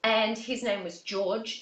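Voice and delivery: usual speaking voice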